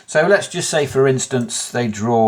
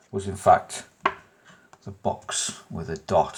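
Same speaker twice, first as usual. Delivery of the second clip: hushed dramatic reveal; 5 semitones lower, 7 dB quieter than usual. Acted. Dramatic card reveal to viewer.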